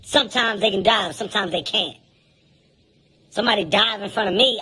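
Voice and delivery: ordinary talk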